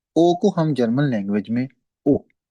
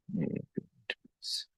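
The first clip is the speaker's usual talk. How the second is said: quiet aside; 15 dB quieter, slower than usual. Real, not acted. Signal issue aside, turned away.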